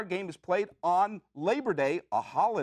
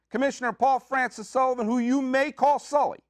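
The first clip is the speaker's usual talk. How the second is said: ordinary talk, nothing marked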